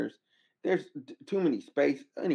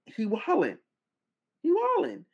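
pitched up, repeated he wildin'; incredulous; 12 semitones higher than usual. Real, not acted. Telling viewers [name] is out of control, high and incredulous. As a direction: rise into disbelief on the second.

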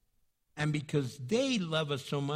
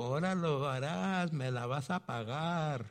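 a Spanish threat, voiced a little lower; unforgiving person; 3 semitones lower than usual. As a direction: mutter a grudging threat in Spanish. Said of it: "They go".

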